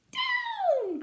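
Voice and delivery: high shouted command; witch's cry. Acted. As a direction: call the broom down with a sharp cry. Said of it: "cried the witch".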